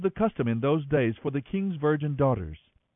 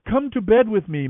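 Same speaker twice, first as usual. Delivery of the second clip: demanding; 7 semitones higher, 6 dB louder than usual. A narrator's reading. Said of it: "he grabbed her and demanded".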